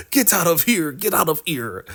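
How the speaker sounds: high-pitched acted shooing away; dismissive order. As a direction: imitate a fed-up woman throwing someone out. Acted woman ordering man away.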